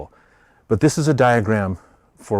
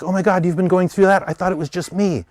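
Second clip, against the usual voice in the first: higher, amazed and relieved; astonished sympathy; 7 semitones higher than usual. Retold or imitated. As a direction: gasp at finding you're not alone. Pawns sharing pain in groups.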